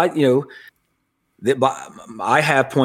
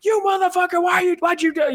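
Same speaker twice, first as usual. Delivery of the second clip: mock screaming match. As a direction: squawk it like someone in a heated argument. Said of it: "it's a screaming match".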